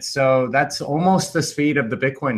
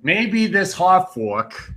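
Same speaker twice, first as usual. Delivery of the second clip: slow, higher mock Eastern European accent, half-hearted; 7 semitones higher, much slower than usual. Shown as a demonstration. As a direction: drag the words out in a botched foreign accent. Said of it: "I can't do it".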